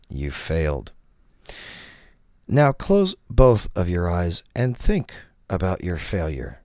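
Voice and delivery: normal talking voice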